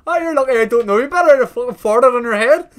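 high, loud, rushed mimicry; incredulous mock quote. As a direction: rattle it off in mocking disbelief. Incredulously mocking Dave's remark.

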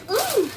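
high, loud "mm" of delight; a sharp, high yummy hum. Real, not acted. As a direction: squeal a pleased "mm". Tasting the cookie.

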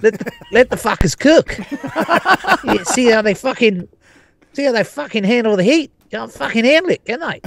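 lightly mimicked coach, high and laughing; acted-out coach. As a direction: retell his glee in a high, laughing voice. Acts out coach wanting players cooked.

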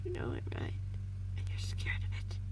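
smooth, menacing whisper. A narrator's reading. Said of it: "the man said smoothly".

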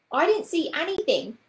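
put-on high, loud childish complaint; complaining. A narrator's reading. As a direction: complain like a left-out little boy. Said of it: "complained Paul".

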